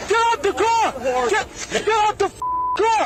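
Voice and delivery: high, repeated yelled commands; shouting. Real, not acted. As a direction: yell it over and over. Officer yelling at driver.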